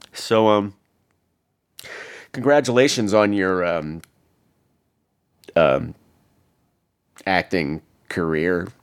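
halting and low, long gaps between words; awkward congratulations. Retold or imitated. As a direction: fumble through an awkward compliment. Awkward come-on to a star.

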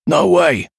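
high, urgent refusal; defiant shout. A narrator's reading. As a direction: call back stubbornly amid a fight. Shouted refusal during fight.

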